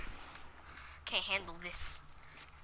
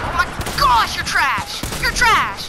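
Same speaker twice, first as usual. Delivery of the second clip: high, loud victory yell; gleeful shout; 9 semitones higher, 12 dB louder than usual. Real, not acted. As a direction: yell it at the beaten enemy. Taunting an opponent in game.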